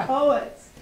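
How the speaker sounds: laughing through the words, breaking up; amused laughter. Real, not acted. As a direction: giggle while saying it. Host laughing while poet steps away.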